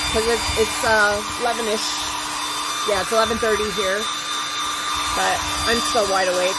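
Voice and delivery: talking loudly over dryer noise; over hair dryer. Real, not acted. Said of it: "so please watch your ears".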